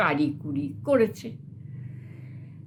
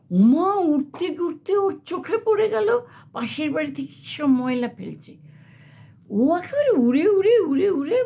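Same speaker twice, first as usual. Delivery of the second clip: excited narration; 7 semitones higher than usual. A narrator's reading. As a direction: build up excitedly as it flies. Crow flies off excitedly.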